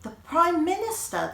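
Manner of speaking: high, loud astonished gasp; the girls can't believe it; gasping surprise. A narrator's reading. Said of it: "the girls gasped".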